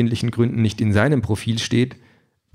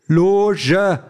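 "Loge" higher and pushed harder; louder; 9 semitones higher, 3 dB louder than usual. A narrator's reading. Sandra repeats "Loge" louder to the deaf android. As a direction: repeat "Loge" with more force. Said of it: "sagt Sandra noch einmal lauter".

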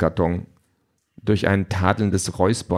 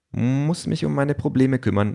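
higher-pitched female character voice; Kiki's voice; 7 semitones higher than usual. A narrator's reading. Kiki tells Peter she is leaving, higher-pitched.

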